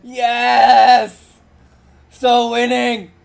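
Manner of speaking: excited. Real, not acted. Cheering after escaping.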